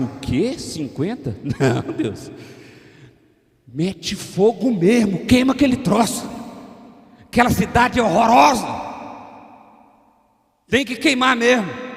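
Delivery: shouted, high and scornful, as if urging fire on the city; mocking self-righteous rant. Congregation mimicking harsh judgment, shouted and scornful. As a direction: jeer at the city, rising into a loud call to burn it. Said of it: "a gente falaria assim".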